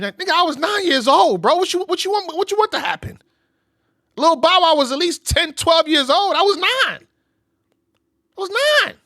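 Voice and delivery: high, loud, hammed-up outrage; indignant. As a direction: act out his outraged disbelief. Voicing [name]'s indignant rebuttal.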